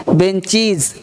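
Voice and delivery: "बेंचीज" falling, with long ee; correct -IZ ending. Shown as a demonstration. Teacher drilling the class, "बेंचीज" ending -cheez. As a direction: say "बेंचीज" with a final z.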